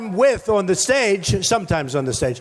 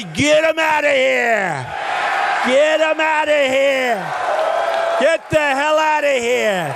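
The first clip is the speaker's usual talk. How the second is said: rally shout; 4 semitones higher, slower than usual. Shown as a demonstration. Performing the famous protester line for the cheering crowd, slow shout. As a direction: stretch each word into a booming command. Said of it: "Are you ready?".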